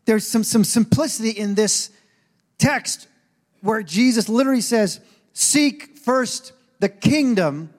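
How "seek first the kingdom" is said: very slow, each word weighted; slow, deliberate scripture reading; slow emphatic quote. Real, not acted. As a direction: stretch out the scripture line. Quoting Jesus slowly.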